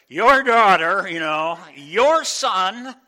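loud slow mock call, leaning on "your"; hammed-up wife blaming dad for the kids; mock exasperated wife. Wife to husband over the misbehaving kids, loud mock complaint. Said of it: "My wife would say to me".

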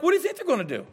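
high, fast exasperated comeback; quick incredulous voice; mock incredulous retort. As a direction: scoff at the offended churchgoer. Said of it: "I'm like".

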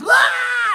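loud, high imitated wail; hammed-up hungry tantrum yell; mock tantrum scream. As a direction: scream like an overgrown baby. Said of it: "starts screaming".

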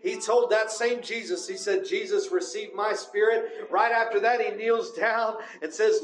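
preaching at full force. Acted. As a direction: lift the congregation, pitch climbing. Sermon climax on Stephen's death.

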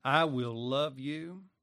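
usual speaking voice

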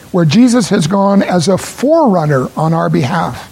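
"forerunner" leaned on with a held r; emphatic "forerunner" in the scripture reading; stress on forerunner. Shown as a demonstration. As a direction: hit "forerunner" and stretch it. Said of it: "The emphasis here that I want to make is on the word forerunner".

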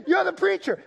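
high, loud retold holler; mimicked yell. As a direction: imitate the stranger shouting in recognition. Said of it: "And then he yells out".

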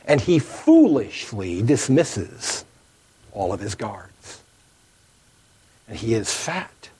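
ordinary talk, nothing marked

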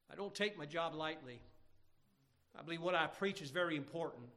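ordinary delivery

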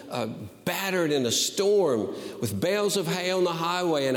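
high, fervent preacher's cry; fervent pulpit voice; impassioned preaching. Acted. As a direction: preach it out with fire. Preaching at full force.

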